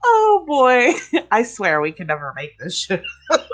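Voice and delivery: ordinary delivery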